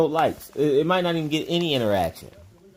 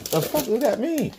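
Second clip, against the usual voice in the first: baffled; 9 semitones higher than usual. Retold or imitated. Baffled retold reaction.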